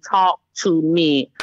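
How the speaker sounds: slow, low, weighted "talk to me"; emphatic insistence. Real, not acted. Mother correcting the host's meaning, slow firm "talk to me". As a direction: space out "talk to me", each word deliberate.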